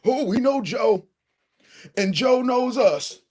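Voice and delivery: mocking Jim Clyburn. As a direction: lay on the old politician's charm. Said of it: "and say".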